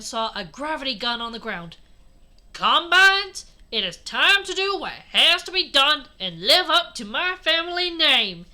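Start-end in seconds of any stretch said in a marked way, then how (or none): 2.5-8.5 loud southern hero speech. A narrator's reading. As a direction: declaim high and loud with a southern twang. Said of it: "doing the southern voice".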